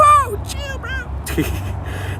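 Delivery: very high squeaky whoa, breath fading out; mock-alarmed squeak. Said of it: "And I'm like".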